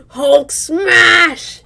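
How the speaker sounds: loud, high mock monster shout; Hulk roar. Teasing his friend mid-game, a loud mock Hulk shout. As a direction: bellow it like a cartoon brute.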